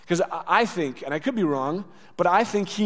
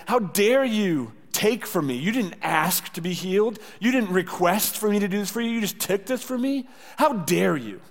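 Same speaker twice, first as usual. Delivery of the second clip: higher, indignant accusation; condemning rebuke; 5 semitones higher than usual. Acted. Imagined Jesus accusing woman of taking healing, indignant. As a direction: build outrage, then hit the last words hard. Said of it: "afraid that he's gonna condemn her".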